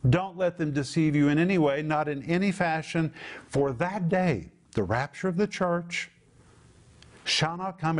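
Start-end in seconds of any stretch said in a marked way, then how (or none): none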